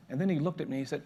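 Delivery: usual speaking voice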